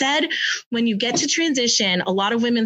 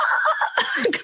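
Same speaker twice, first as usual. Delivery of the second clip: high laugh; 13 semitones higher than usual. Real, not acted. Laughing after retold swear.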